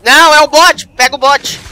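loud high urgent plea; urgent shout. Real, not acted. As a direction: shout at your teammate. Urging rescuer, panicked.

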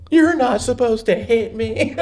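milk it like a hurt brat; bratty kid wailing. Retold or imitated. Kid protesting to mom after a slap. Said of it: "like a little shithead".

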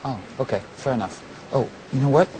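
usual speaking voice

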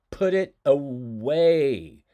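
loud and slow, each word set down hard; stern order. Shown as a demonstration. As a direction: lay down the law. Teacher repeats the order.

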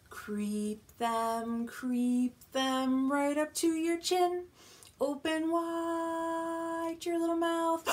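playful suspense climb; creeping build-up. Acted. Rhyme builds up to chin.

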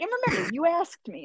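very high, playful mock disclaimer; teasing reminder. Judge reminding champion it was her question, teasing.

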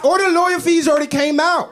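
high, loud laughing exclamation; excited joke. Real, not acted. Joking about the wrapper's cut, loud. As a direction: yell the punchline up high.

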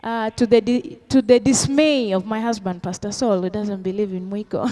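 usual speaking voice

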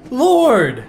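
high startled cry of dismay; loud exclamation. Real, not acted. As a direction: cry out in shock.

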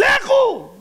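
high, drawn-out shout; shouted order. Acted. Egyptians driving the Israelites out at midnight, shouting. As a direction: shout it as a desperate order to leave.

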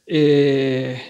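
ordinary delivery

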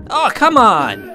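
high, loud, drawn-out protest; real frustrated cry at an unfair hit; exasperated outburst. Real, not acted. Player caught by door popping, loud exasperated protest.

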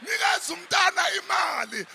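high, loud mocking-up of kids shouting; classmates' chorus. Classmates urging the uncle to give the child money, high imitated shouting. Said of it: "the other kids in the class says".

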